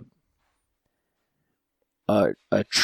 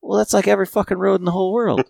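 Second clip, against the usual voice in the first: exasperated; 10 semitones higher, 4 dB louder, faster than usual. Real, not acted. Exasperated comment on Frank.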